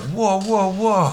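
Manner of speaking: high, alarmed backing-off whoas; startled whoa. Retold or imitated. Retold arrest, startled at tasers.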